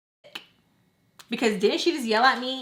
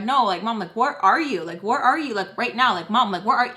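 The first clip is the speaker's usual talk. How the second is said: insistent demand; 2 semitones higher, 7 dB louder than usual. Retold or imitated. Pressing mom for an avoided answer.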